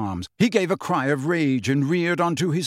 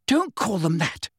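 mildly furious rebuke, pitched up; understated fury, higher voice; 5 semitones higher than usual. A narrator's reading. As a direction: scold in anger without raising the volume. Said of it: "Hermione said furiously".

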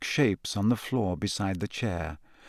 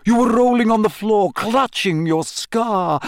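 high, insistent theatrical teacher; excited insistence settling into coaxing; Professor Trelawney; 5 semitones higher, 3 dB louder than usual. A narrator's reading. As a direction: press a pupil dramatically, starting high and loud. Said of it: "said Professor Trelawney".

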